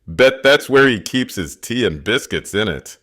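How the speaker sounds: put-on jeering street teen voice; sneering teen taunt; mocking teen voice.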